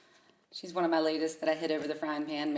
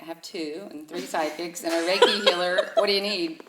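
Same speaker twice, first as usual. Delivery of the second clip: laughing quote; 8 dB louder than usual. Retold or imitated. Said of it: "I'm like".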